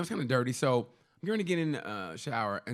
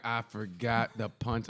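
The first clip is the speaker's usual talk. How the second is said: slow dazed mumble; 3 semitones lower, 3 dB quieter, much slower than usual. Retold or imitated. Retold forgetting punchline.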